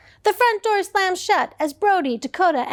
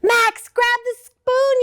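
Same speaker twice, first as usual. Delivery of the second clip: higher, louder yell to Max; 8 semitones higher, 3 dB louder than usual. Acted. Dakota yelling to Max in the soup, loud. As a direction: yell down into the pot. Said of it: "yelled Dakota".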